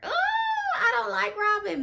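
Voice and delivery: high, slow, drawn-out "Ooh"; emphatic dislike. Real, not acted. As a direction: stretch the ooh up high in disgust.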